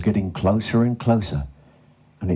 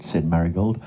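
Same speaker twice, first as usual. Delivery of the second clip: usual speaking voice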